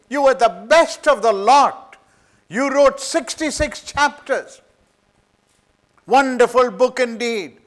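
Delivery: high, loud, admiring preacher's flattery; preaching with mock praise. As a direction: heap praise on him from the pulpit. Addressing Isaiah in praise, preaching.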